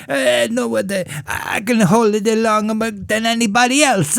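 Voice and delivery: high, proud bragging voice; boastful kid. Retold or imitated. Burned boy boasts to friends, high proud brag. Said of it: "he'd be like".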